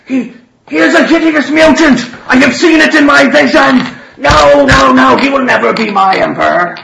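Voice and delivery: high, loud ranting denunciation; furious shout. Acted. Father Russian slams the table denouncing Wolfgang, furious high shout. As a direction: thunder it like a zealot with a vision.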